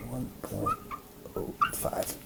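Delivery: soft, whispery echo while writing; hushed, barely voiced note to self; murmuring to self. Real, not acted. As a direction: repeat it under your breath. Repeats result while writing it.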